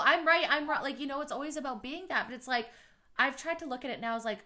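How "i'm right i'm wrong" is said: much higher, louder mock of an egotist insisting. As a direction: parody the self-righteous perfectionist. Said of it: "people are so egotistical".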